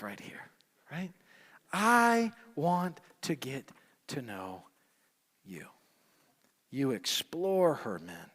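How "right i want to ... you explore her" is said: ordinary delivery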